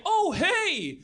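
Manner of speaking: high, hammy hello; a bad actor's cheery hello; mock killer greeting. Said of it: "he goes like".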